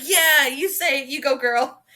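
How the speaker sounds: high, loud cheer for the author. Retold or imitated. Fan cheering the author on in the note. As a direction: hype her up like a fan.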